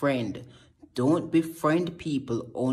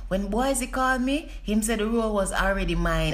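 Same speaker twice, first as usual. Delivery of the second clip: high, breathy diva guest voice; cheese celebrity guest; 6 semitones higher than usual. Acted. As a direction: play the flattered celebrity guest.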